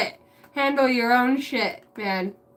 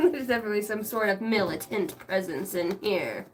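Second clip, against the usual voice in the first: ordinary talk, nothing marked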